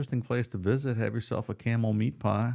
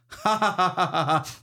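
high mock cackle read off the page; hammed-up cackle; 9 semitones higher, 3 dB louder than usual. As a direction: cackle it like a loony. Reads transcript's laughter.

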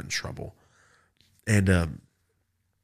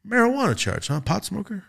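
put-on higher, skeptical old judge voice; mock judge; 10 semitones higher than usual. The old judge, reading the charge aloud, skeptically. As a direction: ask it like a dry old judge. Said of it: "He goes".

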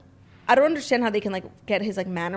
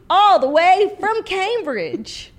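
higher, louder, slow through laughter; laughing mock royal; 10 semitones higher, 7 dB louder, much slower than usual. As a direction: crack up mid-impression.

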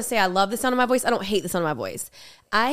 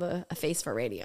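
deadpan tease; 6 semitones lower, 9 dB quieter than usual. Pretend. Teasing joke, drops voice.

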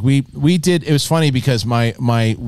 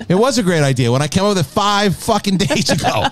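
mock annoyed wife; 9 semitones higher than usual. Wife's annoyed reply, imitated.

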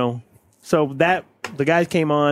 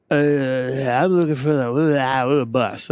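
mumbling stranger; 5 semitones lower, much slower than usual. Retold or imitated. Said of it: "no, he was mumbling".

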